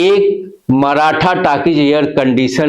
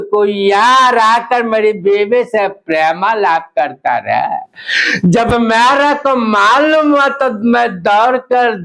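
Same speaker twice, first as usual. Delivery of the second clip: put-on South Indian accent; 3 semitones higher than usual. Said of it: "दोनों का अंदाज आपके बीच पेश कर रहा हूं".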